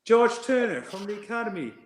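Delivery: high-pitched earnest explanation; mock high voice on the phone; young player's voice. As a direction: imitate an academy player introducing himself. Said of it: "And he went".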